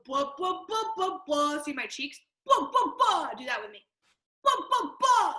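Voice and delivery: dopey ghost. Shown as a demonstration. Voice teacher demonstrates a dopey ghost blah exercise. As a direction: flap the cheeks on a silly blah. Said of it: "These are called bois".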